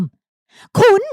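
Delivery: sudden high startled "คุณ"; shocked cry. A narrator's reading. Woman startled by the owner's words, high shocked cry. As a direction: caught off guard, voice jumps up. She gasps at his accusation.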